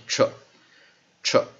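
"tr" as one blended onset, three separate beats; consonant blend tr. Shown as a demonstration. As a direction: punch out "tr", pausing after each. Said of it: "一般来说T R我们就念tr".

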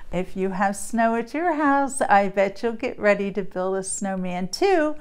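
ordinary delivery, nothing marked

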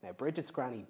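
ordinary delivery, nothing marked

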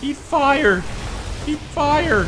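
high excited in-game yell; battle cry. Real, not acted. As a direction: shout it while firing at enemies. Yelling at enemies in game.

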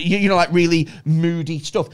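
ordinary talk, nothing marked

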